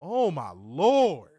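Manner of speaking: slow, drawn-out groan of disbelief; exasperated. Real, not acted. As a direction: drag it out in exasperation. Exasperated reaction.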